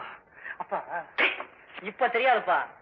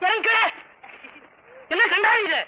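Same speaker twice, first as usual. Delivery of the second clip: shocked shout; 7 semitones higher than usual. Acted. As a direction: push her off in alarm. Director shocked by marriage proposal. (அதிர்ச்சியில் கத்தல்)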